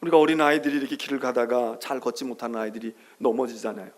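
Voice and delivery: ordinary talk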